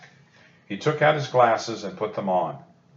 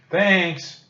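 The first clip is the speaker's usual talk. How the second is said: a high, louder call of thanks; mild shout after the leaping dolphins; 9 semitones higher, 5 dB louder than usual. A narrator's reading. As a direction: call out across the water. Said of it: "Jack shouted".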